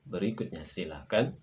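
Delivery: ordinary talk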